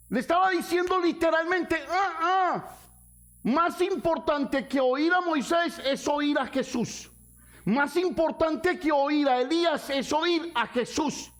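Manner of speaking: high, insistent pulpit voice; fervent preaching. Acted. Pastor explaining the heavenly voice, insistent preaching. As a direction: drive the point home.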